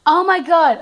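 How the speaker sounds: sudden high, loud exclaim; surprised. Real, not acted. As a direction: blurt it out in shock. Realizes she named wrong horse.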